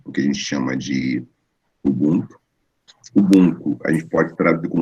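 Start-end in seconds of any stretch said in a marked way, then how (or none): none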